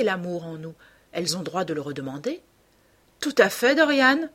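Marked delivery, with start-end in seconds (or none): 3.2-4.3 exclaimed, raised and a bit louder; exclamation. A narrator's reading. Hallward cries out agreement to Dorian, exclamation. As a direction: cry out in hearty agreement. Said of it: "s'écria Hallward".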